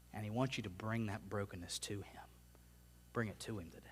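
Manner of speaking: softly whispered invitation; a quiet whispered plea. Acted. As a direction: whisper it gently to one listener. Tender invitation to the listener.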